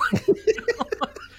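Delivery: real high laughter bursting out. Real, not acted. Laughing at the Jersey accent imitation, high and real. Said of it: "Dude, her accent".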